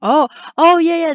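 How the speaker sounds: sudden high, loud outburst; excited realization. Real, not acted. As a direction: burst out loudly as it suddenly clicks. Suddenly recognises the event screen.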